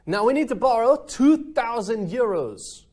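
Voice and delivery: high, loud hammed-up French accent on "Now we need to borrow two thousand euros". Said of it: "I'm gonna stop speaking in my French accent now".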